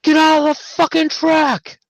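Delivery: high, loud furious yell; retold scream, done at full force. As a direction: bellow it at the clumsy gunny. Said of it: "scream at him".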